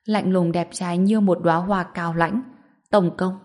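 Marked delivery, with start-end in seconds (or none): none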